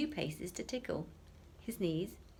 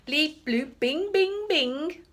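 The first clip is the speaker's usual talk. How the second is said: high loud electronic bleeps; malfunctioning robot noises; robot beeps; 8 semitones higher, 11 dB louder, much slower than usual. A narrator's reading. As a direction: beep like a broken robot.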